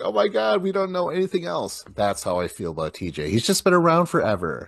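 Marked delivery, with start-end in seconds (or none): 0.0-1.8 high, hammed-up wail of grieving people; mock crying. Imitating Castro's subjects crying over him, high mock wail. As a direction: ham up distraught mourners, pitched high. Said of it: "they were, like, crying".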